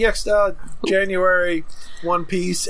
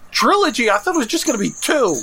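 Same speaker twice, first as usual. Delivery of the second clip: sharp high surprised question; 6 semitones higher than usual. Real, not acted. Surprised at news.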